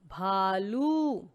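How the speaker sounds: very slow, stretched teaching read; slow blended word; drawn-out word. Shown as a demonstration. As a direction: stretch each syllable for the class. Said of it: "और भा के पीछे है".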